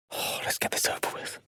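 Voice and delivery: whispered reluctant sigh of words; weary whisper. Acted. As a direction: breathe it out like a grudging aside. Reluctant aside to himself.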